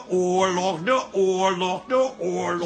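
weary, mocking singsong of de oorlog; fading mock drone; mocking repetition. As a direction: roll your eyes through de oorlog. Mocking father's war stories.

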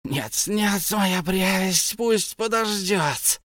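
high, slow hissed refusal; Gollum hissing. A narrator's reading. Gollum hisses a refusal to his precious. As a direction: hiss it out. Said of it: "прошипел Голум".

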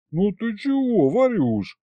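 mildly wounded, high-pitched protest; hurt grumble. A narrator's reading. Husband to his departing wife, mildly offended grumble. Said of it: "обиженно проворчал я ей в спину".

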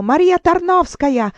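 high, loud cry of the name; a relentless cry she cannot shut out; shouted name. Acted. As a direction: scream the name at her. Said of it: "ma quel grido".

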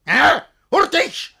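high, loud barked orders; furious demand. Acted. Master demands servants speak at once, shouting. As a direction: force an answer now.

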